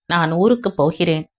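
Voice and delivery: usual speaking voice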